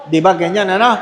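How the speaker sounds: normal talking voice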